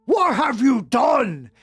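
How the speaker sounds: slow, stern, lightly voiced headmaster; angry Dumbledore. Dumbledore confronting Ebony, slow and stern. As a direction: drag out the accusation slowly. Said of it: "Dumbledore walked in angrily".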